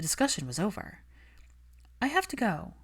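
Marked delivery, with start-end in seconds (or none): 2.0-2.7 put-on lightly raised, brisk young woman; Hermione's voice. Hermione brushing off Malfoy, cool and defensive. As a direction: keep her cool and a little defensive.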